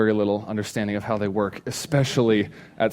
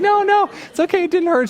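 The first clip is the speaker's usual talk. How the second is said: put-on very high, squeaky girlfriend voice holding back tears; mock high voice of a teen girl pretending it didn't hurt; brave girlfriend; 5 dB louder than usual. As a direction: play her insisting she's fine through the hurt. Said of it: "She's like".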